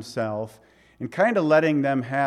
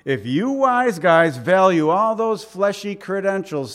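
louder, higher sarcastic jab at rivals; holy sarcasm; 5 semitones higher, 7 dB louder than usual. Acted. Paul taking on boastful rivals, sarcastic. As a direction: lean hard into mock-impressed sarcasm. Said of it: "throws in a little holy sarcasm".

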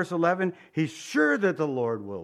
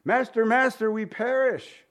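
higher, urgent cry for help; panicked disciples; 8 semitones higher, 4 dB louder than usual. Retold or imitated. Preacher voicing disciples crying in the storm, hammed up. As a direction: cry out like men sinking. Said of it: "They were crying in that boat".

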